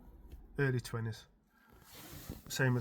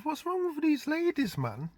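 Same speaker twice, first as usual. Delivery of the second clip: higher mock bafflement; baffled inner voice; 14 semitones higher than usual. Dad puzzling over the flirty ladies, in a higher voice. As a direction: act out his puzzled thought. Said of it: "I'm thinking to myself".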